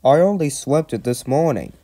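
high-pitched indignant protest; grumbling. A narrator's reading. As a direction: complain as a put-out guard. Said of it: "grumbled the guard".

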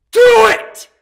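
high excited yell; playful shout. Real, not acted. Urging viewers to subscribe.

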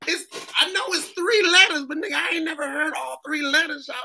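high-pitched exasperated disbelief; hammed-up incredulous customer-service complaint; mock bafflement. Acted baffled listener to his accent.

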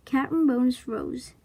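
usual speaking voice

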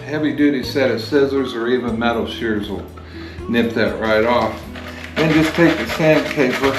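normal talking voice

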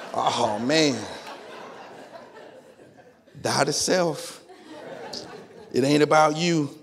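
low, slow, laughing self-talk; rueful chuckle. Retold or imitated. Holding the crying baby he talks himself into serving, rueful laugh. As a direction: laugh at yourself while giving in.

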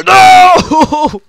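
very high, loud shout of panic; panicked yell. Real, not acted. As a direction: scream as you lose. Panicked game moment.

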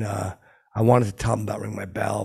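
ordinary talk

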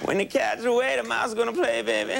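lightly voiced Clinton as a smooth pimp; Clinton impression. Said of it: "that's my Clinton impression".

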